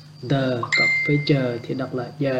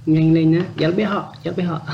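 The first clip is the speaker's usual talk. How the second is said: higher-pitched, insistent hurry-up; urging someone on; 4 semitones higher, 4 dB louder than usual. Acted. Teacher acts out prodding someone to hurry, urging. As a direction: push them to move, starting loud. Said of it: "khi mà mình thôi thúc ai đó".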